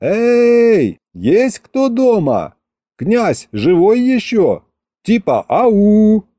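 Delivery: high, drawn-out calling shout; hollering. A narrator's reading. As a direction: call up to the wall with long held vowels. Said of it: "я и заорал". (орёт во всю глотку)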